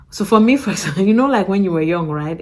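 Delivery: ordinary talk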